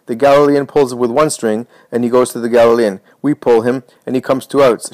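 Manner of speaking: ordinary delivery, nothing marked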